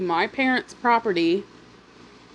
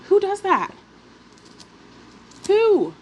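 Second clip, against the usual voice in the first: outraged disbelief; 10 semitones higher than usual. Real, not acted. Outraged at neighbor's nerve.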